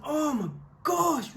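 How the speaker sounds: high, drawn-out mock dismay; retold dismay. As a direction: stretch it out in rising panic. Retold first shock at her crying.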